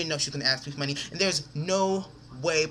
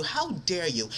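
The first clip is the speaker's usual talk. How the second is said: ordinary talk, nothing marked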